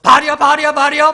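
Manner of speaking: loud, high invocation repeated without a break; imitating zealots crying to their god; frantic mock prayer. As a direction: chant the name urgently at full voice. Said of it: "막 하면서 막 기도했을 거예요".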